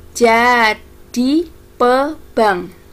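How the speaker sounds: very slow, separated syllables. Shown as a demonstration. Teacher points out lyric syllables to pupils, slow and separated. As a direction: spell out "Jadi Pebang" slowly, one beat per syllable.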